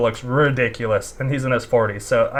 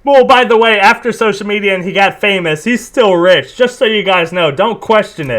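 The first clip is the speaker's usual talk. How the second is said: loud, raised-pitch sarcastic announcement; mock insistence; 5 semitones higher, 6 dB louder than usual. Reactor parroting the boast to viewers, loud mock insistence. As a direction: mockingly insist on it, loud and high.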